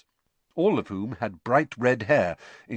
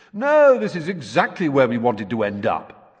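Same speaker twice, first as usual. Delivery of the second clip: sarcastic; 3 semitones higher, 6 dB louder than usual. Acted. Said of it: "very sarcastically".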